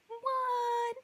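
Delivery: high, incredulous squeak of a what; shocked what. Retold or imitated. Told the couches were sawn in half, stunned high what. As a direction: disbelief jumps way up in pitch.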